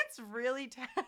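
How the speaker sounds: laughing through the words; loud amused laugh while talking. Real, not acted. Laughing after the tasting.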